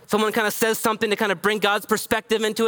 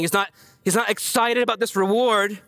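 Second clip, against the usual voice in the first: usual speaking voice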